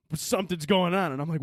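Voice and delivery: ordinary talk, nothing marked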